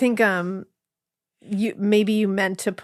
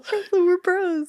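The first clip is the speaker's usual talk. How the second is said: high, drawn-out playful plea; retold mock protest; 10 semitones higher than usual. Co-host re-enacting her line for a friend, mock protest.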